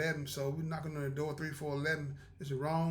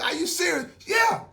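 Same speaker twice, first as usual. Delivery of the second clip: loud, high incredulous question; 14 semitones higher, 12 dB louder than usual. Retold or imitated. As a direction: blurt it out in disbelief.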